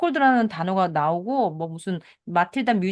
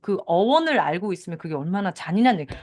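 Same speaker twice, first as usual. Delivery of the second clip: usual speaking voice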